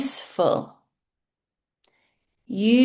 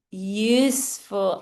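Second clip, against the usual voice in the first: ordinary delivery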